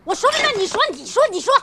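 high-pitched, huffy retort; exasperated. Acted. Bickering over who explains the swap, exasperated. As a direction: give up and throw it back in a huff.

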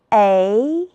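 second-tone "A", drawn out and climbing; tone drill. Shown as a demonstration. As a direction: glide "A" upward, second tone. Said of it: "和老师一起来读读".

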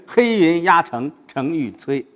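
firm, slow, compact recitation; imposing momentum. Shown as a demonstration. Teacher showing the student how to build momentum, imposing recitation. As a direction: build the force of a looming army. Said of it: "先把这个气势给造出来".

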